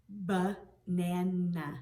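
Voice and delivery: "Banana" very slowly, broken after the first syllable; sounded out. Shown as a demonstration. Teacher checking for the I sound, slowly breaking up "Banana". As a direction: split "Banana" as ba, nana, each part stretched. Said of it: "Is there an I sound in".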